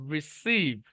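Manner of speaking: Receive with a long, stressed SEEV; light ri, stretch SEEV in receive; stress on second syllable. Shown as a demonstration. Teacher modelling the word, receive stressed on SEEV. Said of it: "এখানে স্ট্রেসটা যাবে".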